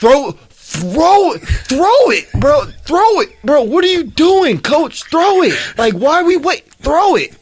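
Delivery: loud, very high-pitched pleading at a coach; exasperated yelling. Retold or imitated. Retold yelling at coach to throw.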